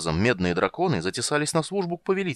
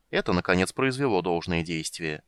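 usual speaking voice

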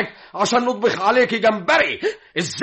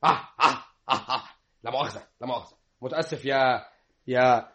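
mumbling; 8 semitones lower, 6 dB quieter, faster than usual. Acted. Magdi mumbles, fading off.

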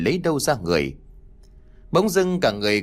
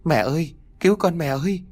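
high, shaking cry for her mother; a lost girl sobbing for her mother; terrified crying plea; 5 semitones higher than usual. A narrator's reading. Lost girl in graveyard cries for mother, high frightened sob. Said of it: "khóc lìn rưng rức".

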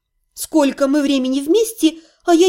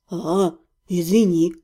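male low apology; 8 semitones lower, slower than usual. A narrator's reading. Embarrassed man apologises.

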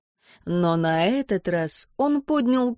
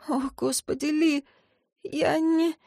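tearful; 7 semitones higher, 3 dB quieter than usual. A narrator's reading. Tearful heroine.

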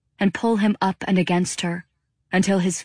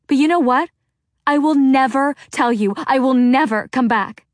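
high, loud and building fury; a daughter's furious farewell to her mother; angry tirade; 7 semitones higher, 4 dB louder than usual. Acted. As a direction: rant at her mother until storming off.

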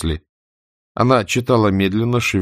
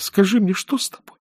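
tender; 11 semitones higher than usual. A narrator's reading. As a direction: coax it out of him gently. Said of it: "ласково сказала Катя".

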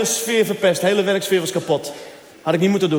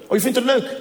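higher, needling wife voice; suspicious wife; 7 semitones higher than usual. Retold or imitated. Wife pressing her husband about the cleaner, higher needling voice. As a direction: catch him out, pitched up.

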